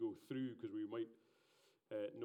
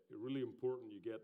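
ordinary delivery

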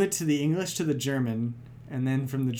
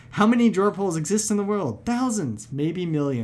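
mock wonder; 5 semitones higher, 5 dB louder than usual. Mock-amazed musing on drawer pulls.

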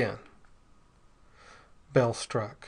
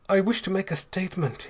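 higher-pitched, sharp declaration; said sharply; 7 semitones higher than usual. A narrator's reading. Wounded man addressing the suspicious crowd, sharply. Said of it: "he said sharply".

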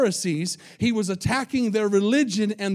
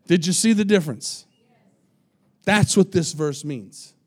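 normal talking voice

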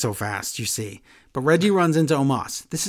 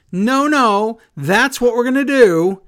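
higher and louder, cutting her off; insistent retold shout; 7 semitones higher, 6 dB louder than usual. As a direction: overrule her partner loudly. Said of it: "And Nikki goes".

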